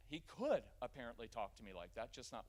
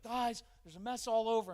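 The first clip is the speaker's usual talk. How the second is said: higher, louder exasperated call into the room; frazzled yell; 8 semitones higher, 9 dB louder than usual. Retold or imitated. Parent coming home to toddlers, loud and frazzled. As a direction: burst in sounding overwhelmed. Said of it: "instead of coming in and being like".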